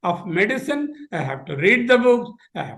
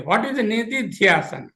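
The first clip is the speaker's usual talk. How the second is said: usual speaking voice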